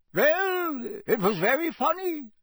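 put-on high, accented, unapologetic reply; Bulgarian minister. A narrator's reading. Foreign minister answers outraged colleague.

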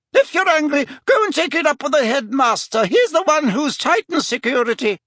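high, put-on lady's voice, scolding; irritated fat lady. Fat lady refusing Harry entry, huffy. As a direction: tell him off in high-pitched indignation.

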